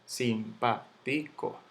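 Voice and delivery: simpático split as sim-pá-ti-co; syllable by syllable. Shown as a demonstration. Teacher sounding out the word for students, slow separate syllables. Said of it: "Yo digo simpático".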